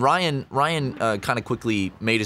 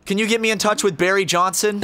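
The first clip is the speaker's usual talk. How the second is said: high-pitched, mildly raised mock yell; imitating yelling weirdo; 7 semitones higher, 4 dB louder than usual. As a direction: play the ranting stranger making a demand. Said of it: "he's just yelling nonsense".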